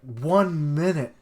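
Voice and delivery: disbelieving echo of the claim; incredulous. Real, not acted. Reader disbelieving the villain's one-minute claim, incredulous.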